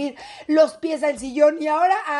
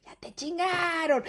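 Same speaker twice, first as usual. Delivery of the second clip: slow, softer gloating taunt; taunting kid; 6 dB quieter than usual. Acted. Kids eyeing their scolded mom, slow taunt. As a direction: draw it out, quietly gloating. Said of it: "te voltean a ver con ojo de".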